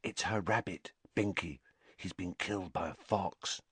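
hushed, very soft sad news; soft confiding murmur; whispered. A narrator's reading. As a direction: break it very quietly. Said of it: "Parvati whispered".

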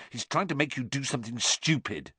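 understated fierce warning; savage. A narrator's reading. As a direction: warn a friend crossly. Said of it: "said Ron savagely".

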